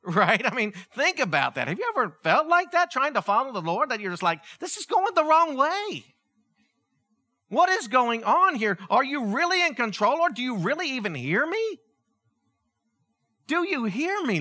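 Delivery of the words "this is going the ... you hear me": high, emphatic pleading questions; exasperated complaint. Retold or imitated. Believer questioning the Lord when life goes backwards, high exasperated plea. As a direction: plead upward in mock exasperation.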